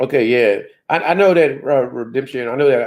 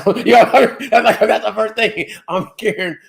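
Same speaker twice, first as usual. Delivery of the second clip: high-pitched, laughing through the words; giggly and high, barely holding it; 9 semitones higher than usual. Real, not acted. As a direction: crack up at your own joke while talking. Cracking up at own joke.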